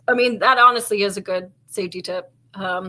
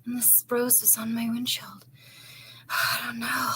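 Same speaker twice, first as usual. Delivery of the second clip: whisper; 9 dB quieter than usual. Retold or imitated. Girl retelling feeling weird.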